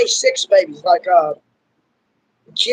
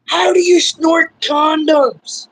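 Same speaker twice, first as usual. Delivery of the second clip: slow, raised, incredulous retort; baffled question; 5 semitones higher, slower than usual. Retold or imitated. Son baffled by dad's condom question, slow and incredulous. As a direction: spell out your disbelief slowly. Retelling baffled reply to dad.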